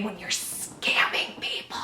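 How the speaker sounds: soft unvoiced whisper. Real, not acted. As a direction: breathe it out as a secret aside. Joking aside about scammers.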